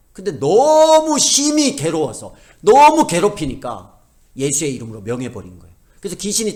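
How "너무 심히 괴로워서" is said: "너무" stretched long and high; drawn-out emphasis. Shown as a demonstration. Paul at last overwhelmed by torment, "너무" drawn out long and high. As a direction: draw out "너무" loud and high, then "심히 괴로워서" slowly.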